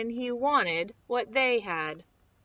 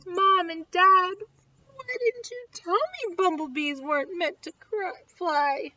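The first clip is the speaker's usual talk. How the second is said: tearful; 7 semitones higher, 5 dB louder than usual. Acted. Buzzy bursts in with tear.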